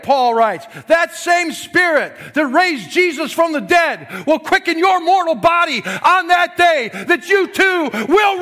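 loud, high, full-force preaching; yelling the gospel, not at anyone. Real, not acted. Preacher to his morning congregation, preaching at full force. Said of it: "I'm preaching with force this morning".

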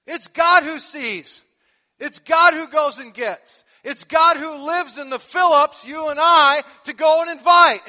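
loud, slow, emphatic preaching; preaching at full force. Acted. Pastor driving home to the congregation, loud and slow. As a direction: hammer each phrase home.

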